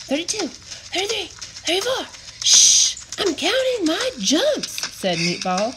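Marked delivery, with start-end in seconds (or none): 0.1-2.1 high, quick counting voice; counting under breath. A narrator's reading. Meatball counting jumps. 2.4-3.0 loud unvoiced shush; sharp shush. A narrator's reading. Meatball shushing. 3.2-4.7 high, quick counting voice; counting under breath. A narrator's reading. Meatball voice.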